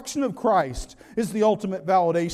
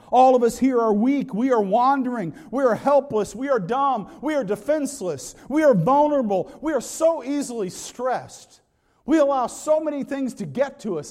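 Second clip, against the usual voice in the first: raised, insistent run of short statements; impassioned preaching; 4 semitones higher, 3 dB louder than usual. Real, not acted. Preacher telling the flock they are helpless sheep, impassioned and insistent. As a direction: drive the list home, each phrase punched.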